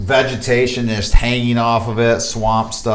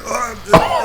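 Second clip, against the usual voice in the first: laughing gag. Acted. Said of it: "you're able to kind of, like".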